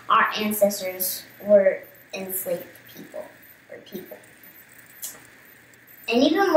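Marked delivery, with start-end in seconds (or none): 2.1-4.2 whispered aside. Real, not acted.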